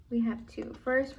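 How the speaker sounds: ordinary delivery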